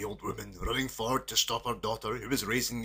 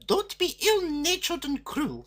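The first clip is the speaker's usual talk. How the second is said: much higher and slower, gentle scolding; scolding mother; 5 dB louder, slower than usual. A narrator's reading. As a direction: chide the daughter kindly. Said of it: "said her mother".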